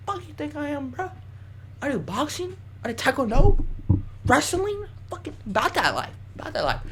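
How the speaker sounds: high-pitched, hammed-up fighter's bravado; playful high-voiced threat; mock tough-guy boasting. Boasting to the man he pushed.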